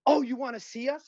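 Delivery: high-pitched mock yell; retold yelling cop. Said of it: "This woman cop starts yelling at me".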